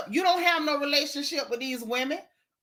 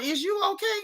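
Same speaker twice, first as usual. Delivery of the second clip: much higher, mock-worried question; mock concern; 9 semitones higher than usual. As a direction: squeak it out like fake concern. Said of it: "had to ask Robert".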